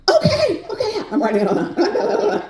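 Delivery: high, laughing eager okays; a hint of a nervous rookie scribbling notes; lightly voiced eager trainee. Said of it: "I go".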